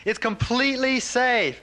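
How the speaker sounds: high, slow, stretched-out call; retold yelling. Checking safety over the thunderous waterfall, retold yell. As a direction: holler each word across the roar. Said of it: "the master screams at me".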